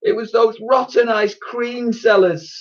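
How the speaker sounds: slow, high wail; high-pitched tearful whine; sobbed complaint. A narrator's reading. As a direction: wail it like an upset schoolchild. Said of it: "sobbed one".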